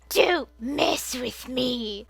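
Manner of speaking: slow, menacing whisper; threatening whisper. Acted. Nervous director warns Raditz.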